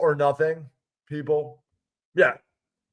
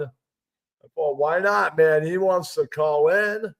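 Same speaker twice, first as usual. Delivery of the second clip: usual speaking voice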